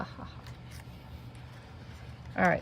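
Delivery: ordinary delivery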